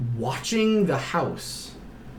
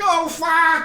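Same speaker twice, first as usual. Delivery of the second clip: very high mock cry of panic; drawn-out comic scream of alarm; alarmed yelp; 13 semitones higher, 3 dB louder than usual. Mother finding her arm on fire, panicked.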